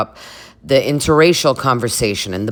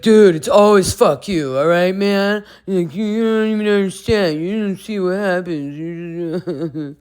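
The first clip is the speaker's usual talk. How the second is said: imitating Kyle; 6 semitones higher than usual. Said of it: "he's like".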